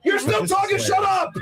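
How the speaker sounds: angry shouting. Real, not acted. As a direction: snap at her to shut up. Host yelling at guest.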